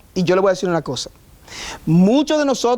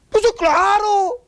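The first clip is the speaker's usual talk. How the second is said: sarcastic agreement; 11 semitones higher, slower than usual. Real, not acted. Sarcastic answer about Jesus' humanity.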